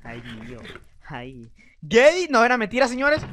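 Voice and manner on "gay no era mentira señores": teasing shout. Real, not acted. Teasing friend.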